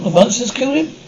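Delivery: disbelieving, pitch climbing into a question; incredulous question. Acted. Parent questioning a child's monster story, incredulous.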